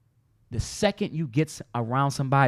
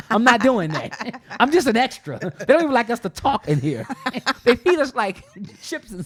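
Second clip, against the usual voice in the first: laughing; 8 semitones higher, 4 dB louder than usual. Real, not acted. Laughing about extra work.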